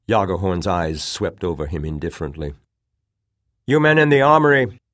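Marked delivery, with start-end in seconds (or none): 3.7-4.8 loud, high call across a hall; ringing shout. A narrator's reading. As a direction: call out clearly to men inside. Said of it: "he shouted".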